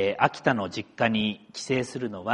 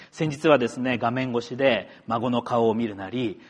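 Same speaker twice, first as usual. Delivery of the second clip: ordinary talk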